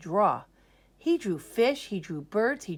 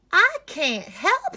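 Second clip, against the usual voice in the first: nurse voice; 12 semitones higher, 7 dB louder, slower than usual. Acted. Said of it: "said the nurse".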